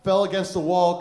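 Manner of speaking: ordinary talk, nothing marked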